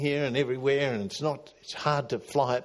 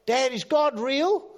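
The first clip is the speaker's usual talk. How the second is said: lightly voiced child, higher and louder; a son asking; 12 semitones higher, 5 dB louder, slower than usual. Boy asks his dad if God is real, light child voice. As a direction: ask like a curious little boy. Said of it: "son comes to him and says".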